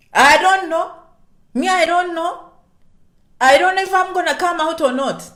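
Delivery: loud, high-pitched mock reluctance; hammed-up, high and loud disavowal; acted protest. Acting out a hesitant person's reply.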